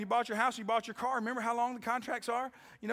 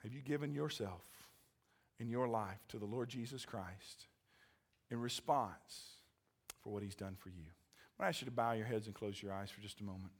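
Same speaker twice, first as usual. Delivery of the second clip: much lower, softer invitation; soft altar call; 5 semitones lower, 4 dB quieter than usual. Acted. Pastor inviting listeners to give their lives, soft and low. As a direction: draw them in gently.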